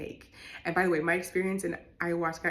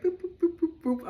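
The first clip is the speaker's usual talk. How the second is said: playful boops; 12 semitones higher, 6 dB louder than usual. Retold or imitated. Happy snake slithering noises.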